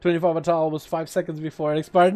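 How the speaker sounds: normal talking voice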